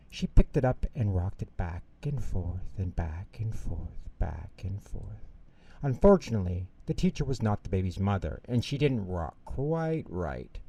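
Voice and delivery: usual speaking voice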